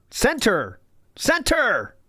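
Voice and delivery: rising mock shout at the screen; yelling commands. Yelling at the software to center.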